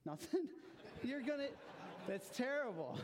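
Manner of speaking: high-pitched, loud disbelief with a laugh; playfully aghast at the answer, voice jumping up; incredulous squawk, breaking into laughter; mock outrage. Jokingly aghast at children's answer.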